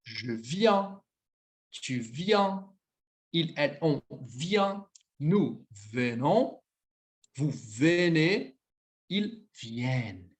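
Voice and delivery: each French form said slowly and clearly for students to copy; teacher spells out the sounds of je viens to ils viennent, unhurried; slow French pronunciation drill. Shown as a demonstration. Teacher modelling French conjugation for Portuguese learners, slow and clear. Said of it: "novamente a pronúncia".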